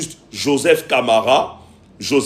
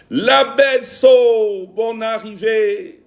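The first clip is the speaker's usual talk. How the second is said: drawn-out welcome; 6 semitones higher, slower than usual. Real, not acted. Welcoming a viewer on live.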